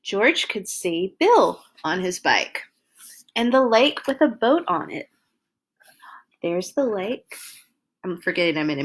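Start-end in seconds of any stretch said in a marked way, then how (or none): 0.0-7.3 put-on lively read-aloud for little kids; reading to children. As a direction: tell it like a bedtime storybook. Reads picture book to children.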